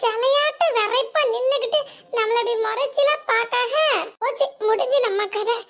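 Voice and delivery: put-on teasing young bride voice; young sister-in-law. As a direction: play the chirpy younger woman.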